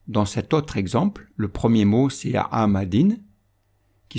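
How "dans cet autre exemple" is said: ordinary talk, nothing marked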